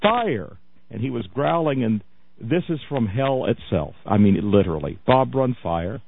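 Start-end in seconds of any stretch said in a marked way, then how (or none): 0.0-0.6 mild mock scream. Host imitating the preacher casting fire, mock screaming. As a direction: imitate a scream without the volume.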